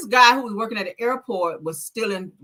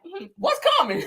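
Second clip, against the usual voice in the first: imitating granddad; 10 semitones higher than usual. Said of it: "He would be like".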